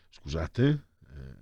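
ordinary delivery

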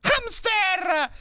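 very high, thin cartoon voice working it out; high chipmunk brain-cell voice; squeaky neuron voice. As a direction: voice the brain cells puzzling it over.

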